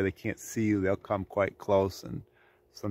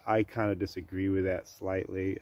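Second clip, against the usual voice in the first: ordinary delivery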